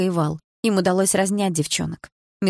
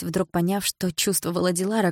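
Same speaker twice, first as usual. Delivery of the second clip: plain reading voice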